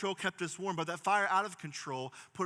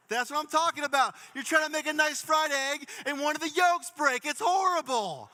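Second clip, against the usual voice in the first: higher and louder, mock outrage over a yolk; worked-up rant; 9 semitones higher, 7 dB louder than usual. Real, not acted. Pastor jokingly vents to the crowd about broken yolks, loud mock outrage.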